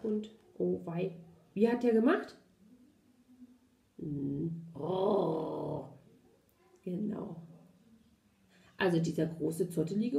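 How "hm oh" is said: low, drawn-out growl; dog growl. Retold or imitated. Showing how the big dog went, low growl.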